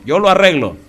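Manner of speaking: braggart's mock boast; half bully. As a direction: puff up like a bully. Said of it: "se va a poner medio bravucón".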